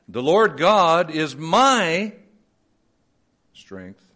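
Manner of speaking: slow, suspended my then a hushed strength; stress on my. Shown as a demonstration. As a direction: linger on my before strength. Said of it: "shift the emphasis in different places".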